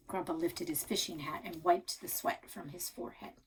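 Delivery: ordinary delivery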